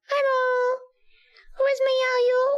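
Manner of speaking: put-on squeaky high baby voice in Dutch. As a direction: coo in Dutch like a toddler. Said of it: "Can you talk in Dutch in a baby voice?".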